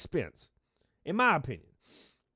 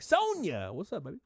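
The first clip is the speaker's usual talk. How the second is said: excited greeting; 4 semitones higher than usual. Real, not acted. Calling out a viewer's name.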